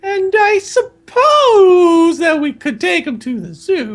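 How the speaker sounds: milk the slowness with a long, swooping SUPPOSE; slow Aunt Petunia. Acted. Aunt made to repeat her line, slow loud falsetto. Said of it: "said Aunt Petunia slowly".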